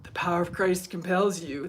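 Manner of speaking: low, soft mock exorcist line. Telling hairdresser why moderators are needed, mock exorcist. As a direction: lightly intone it like a priest casting out. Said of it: "I said".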